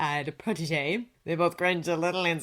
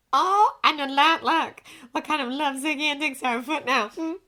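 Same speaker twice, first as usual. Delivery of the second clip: higher, eager teasing voice; a gossipy woman's higher voice; curious woman colleague; 7 semitones higher than usual. A narrator's reading. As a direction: ask it playfully, pitched well up. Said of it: "she asked".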